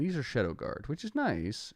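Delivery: ordinary talk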